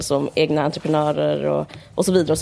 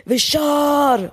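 high shouted party cry; mock drunken shout; 8 semitones higher than usual. Friend at a drunk Stureplan dinner, shouting a cheer. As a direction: yell it like a rowdy toast. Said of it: "ställde sig alla upp och sa så här".

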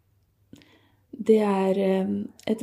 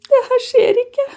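reliving the screamed refusal, rising much higher; retold cry of disbelief, climbing sharply in pitch; retold anguished scream; 6 semitones higher than usual. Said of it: "Men jeg skrek".

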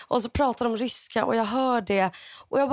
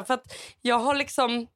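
usual speaking voice

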